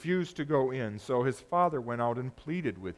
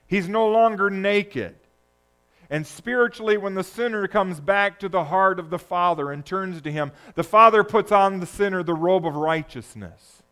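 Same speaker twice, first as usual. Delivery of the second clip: fervent, rising sermon voice; preaching at full force; 4 semitones higher, 7 dB louder than usual. Acted. Pastor preaching to the congregation, loud and fervent. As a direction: drive the point home from the pulpit.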